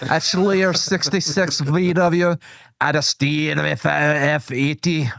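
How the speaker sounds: slow, put-on Scottish accent; badly faked Scottish accent; attempted Scottish accent. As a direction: read names in a laboured fake Scottish. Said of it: "so Scottish accent?".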